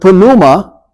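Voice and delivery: "pneuma" with the P pronounced; sounded P. Shown as a demonstration. Teacher giving the class the Greek word, "pneuma" with its P sounded. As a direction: say the P at the start of "pneuma". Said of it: "the P is pronounced".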